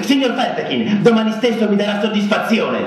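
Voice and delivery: high, formal, pompous challenge; stiff duel challenge. A narrator's reading. Narrator challenging Ferfechin to a duel, pompous. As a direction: demand satisfaction with wounded dignity.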